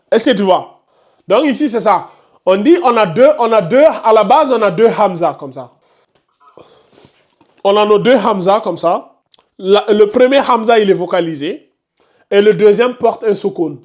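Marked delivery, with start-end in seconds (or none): none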